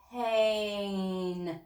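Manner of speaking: Pain drawn out slowly, sound by sound; slow stretched blending. Shown as a demonstration. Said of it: "Say it fast".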